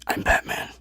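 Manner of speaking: husky, breathy Batman growl; playful mock Batman rasp. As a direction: whisper it like the Dark Knight. Batman catchphrase quoted in banter.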